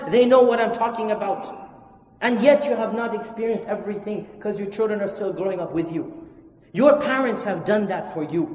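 usual speaking voice

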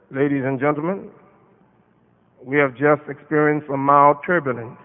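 steady and unhurried, keep the passengers at ease; calm pilot voice. Retold or imitated. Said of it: "In a very calm voice".

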